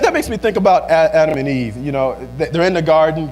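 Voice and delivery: ordinary talk, nothing marked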